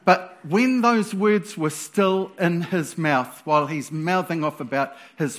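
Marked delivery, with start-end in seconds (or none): none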